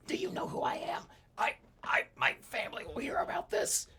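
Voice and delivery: strangled haughty whisper; a choked, entitled threat; cold arrogant heir. Acted. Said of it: "he's like".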